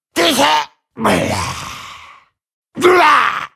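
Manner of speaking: short, explosive attack yells; combat effort cries. Acted. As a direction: grunt and yell with each blow. Game character's combat effort cries.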